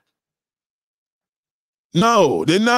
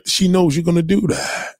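ordinary talk

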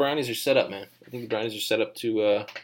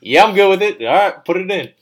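high, loud, carefree okay; mock easy approval; 10 semitones higher, 15 dB louder than usual. Scout approving the Taki Taki pick, mock loud high voice. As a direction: toss off the go-ahead up high and loud. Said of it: "He's like".

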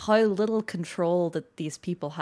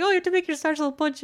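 fast, higher rush through the line; quick retold quote; 9 semitones higher, 3 dB louder, faster than usual. Retelling the character's punch request, quick and eager. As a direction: rattle it off eagerly. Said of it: "he's like".

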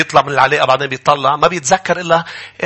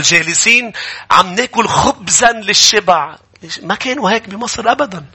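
raised reading voice; scripture reading; 6 semitones higher than usual. Acted. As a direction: proclaim the verse. (قراءة الآية بصوت مرتفع)

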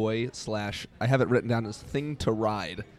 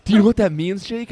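put-on shocked kid; 9 semitones higher, 11 dB louder than usual. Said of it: "and everyone was like".

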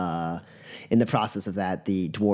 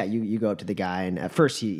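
usual speaking voice

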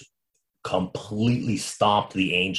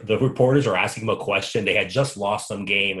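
normal talking voice

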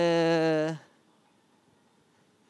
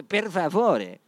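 "Per favore" said louder and higher, with a rolled, drawn-out Italian flourish; emphatic Italian per favore; 3 semitones higher, 6 dB louder, much slower than usual. Shown as a demonstration. Podcaster hams up "Per favore" to sound Italian, louder and higher. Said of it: "para as pessoas pensarem que eu sou italiano".

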